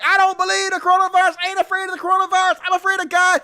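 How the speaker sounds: high, fast hammed-up preacher voice; mock preacher. Mocking the pastor who died of coronavirus, high and fast. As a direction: ham up a defiant pastor. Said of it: "It was like".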